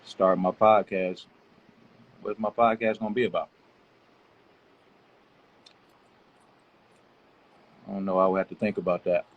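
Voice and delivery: talking while chewing gum loudly. Real, not acted. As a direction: chew gum between the words. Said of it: "Stop chewing gum so loud".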